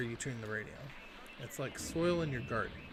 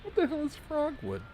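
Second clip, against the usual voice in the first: amused disbelief; 13 semitones higher, 5 dB louder than usual. Real, not acted. Laughing aftermath at dialogue.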